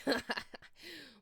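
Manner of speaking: a crude, high cackle; crude laugh. A narrator's reading. Enoby laughing crudely at Snaketail's offer. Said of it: "I started laughing crudely".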